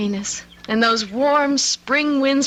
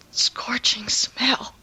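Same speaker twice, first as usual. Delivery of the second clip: horrified whisper; 5 dB quieter than usual. Acted. Whispering the horror.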